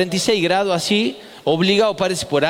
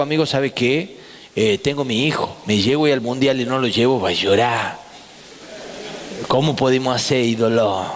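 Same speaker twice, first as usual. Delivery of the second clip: a lower, wheedling plea; pleading low; 7 semitones lower than usual. Retold or imitated. Comic begging the organizer to bring his son, low wheedle. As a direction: sweet-talk him, voice dropped low.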